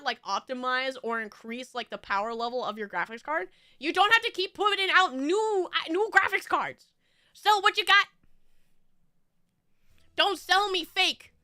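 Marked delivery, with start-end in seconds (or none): 4.3-11.3 angry rant. Real, not acted. Ranting at graphics card makers.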